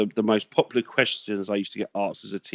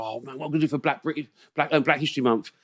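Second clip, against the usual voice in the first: fast, higher mock fretting; imitating rushed people; 4 semitones higher, faster than usual. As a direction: rattle it off like a flustered planner. Imitating people planning History Month.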